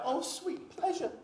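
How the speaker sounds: high, eager cry of delight; young man's high delighted cry; mock youthful cry. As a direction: cry it out like a young man in rapture. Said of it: "should cry out".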